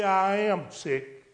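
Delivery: a retold slow confession; said slowly. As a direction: let each word land slowly. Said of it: "said to her slowly".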